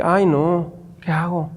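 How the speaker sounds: softly, retelling his own worried panic; a nervous private aside under his breath; worried hush. Retold or imitated. At the gym, a stranger starts crying beside him, hushed and worried.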